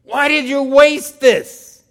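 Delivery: loud, high scolding outburst; scolding shout. Retold or imitated. Disciples scolding the woman who wasted perfume, shouting. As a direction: hurl the question at her. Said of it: "They scolded her".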